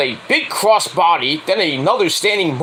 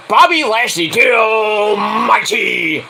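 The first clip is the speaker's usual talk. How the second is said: high, drawn-out announcer shout; ring announcer; 4 semitones higher, slower than usual. Pretend. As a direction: announce the wrestler, stretching the nickname.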